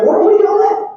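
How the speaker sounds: high, loud, incredulous question; mock-baffled and raring to go; acted eager disbelief. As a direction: pitch it up as a baffled young warrior. Acting David eager for battle.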